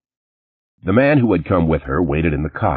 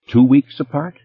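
pointed; 9 semitones higher, 5 dB louder than usual. Acted. Lauren pressing accusation.

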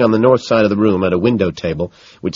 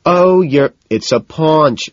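PAUNCH drawn out high, then falling; stressed insistence; 9 semitones higher, 6 dB louder, much slower than usual. A narrator's reading. Man arguing with Evelyn, insistently stressing "paunch". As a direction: insist on "paunch", stretched and pitched up. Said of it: "I stress".

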